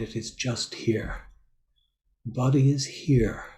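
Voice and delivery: measured meditation guidance with long, silent pauses; guided meditation. Real, not acted. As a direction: leave space after each phrase, guiding listeners into body awareness. Leads a silent-day meditation.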